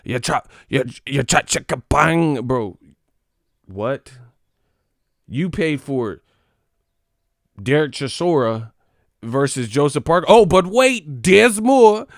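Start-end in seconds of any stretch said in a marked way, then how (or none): 0.0-2.4 put-on British accent, mocking; mock British fan. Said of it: "you talking about". 10.3-12.1 much higher and louder, like a TV ad; mock-excited British-boxing ad voice; infomercial hype. Infomercial parody.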